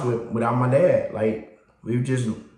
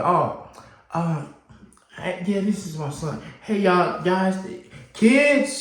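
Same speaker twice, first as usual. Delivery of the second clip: put-on higher, flustered mom calling the kids; mom's voice; 7 semitones higher, slower than usual. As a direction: caught off guard, call everyone out. Mom introduces and calls kids.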